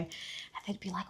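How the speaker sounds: ordinary delivery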